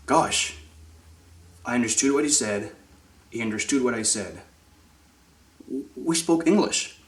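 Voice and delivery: soft, hushed disbelief; hushed, halting wonder; quiet amazement. Acted. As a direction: act out a dawning realisation, quietly. Learner realising they managed a conversation.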